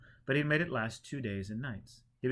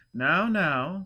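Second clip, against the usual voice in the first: put-on louder, gently scolding old teacher; holy man; 7 semitones higher, 10 dB louder than usual. A narrator's reading. Holy man telling kneeling pilgrim to stop, louder. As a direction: wave off the fuss kindly.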